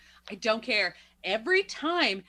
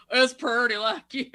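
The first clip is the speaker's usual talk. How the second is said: slow, louder, hammed-up Southern drawl; bad Southern accent; 3 semitones higher, 7 dB louder than usual. Podcaster quoting the movie hero to his sweetheart, bad Southern accent. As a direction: stretch it into a hokey Southern accent. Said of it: "I can't do this accent".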